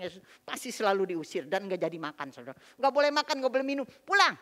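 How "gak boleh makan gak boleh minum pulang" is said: higher, louder mock scolding; angry order. Mother sending the visitors home hungry, imitated angry yell. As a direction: bark the bans like the stern mother.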